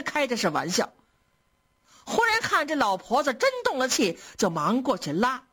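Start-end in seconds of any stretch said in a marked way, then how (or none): none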